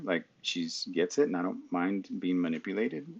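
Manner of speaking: usual speaking voice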